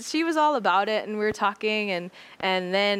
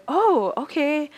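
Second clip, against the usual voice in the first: attendant's voice; 8 semitones higher, 5 dB louder, slower than usual. Retold or imitated. Attendant responds.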